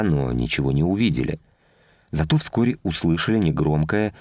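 ordinary delivery, nothing marked

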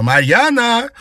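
high, full-voiced summons; loud shout. A narrator's reading. Mistress calling the maid from the kitchen, shouting. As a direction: bellow a name toward another room. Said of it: "громко крикнула".

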